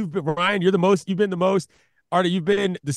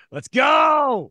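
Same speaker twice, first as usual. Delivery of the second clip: loud, higher rallying shout; excited cheer; 6 semitones higher, 4 dB louder than usual. Real, not acted. Co-host cheering the live podcast news, excited cheer. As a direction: whoop it up for the news.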